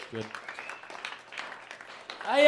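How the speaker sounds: normal talking voice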